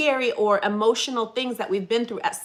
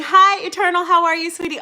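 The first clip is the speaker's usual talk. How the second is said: excited greeting; 7 semitones higher, 8 dB louder than usual. Real, not acted. Greeting viewer.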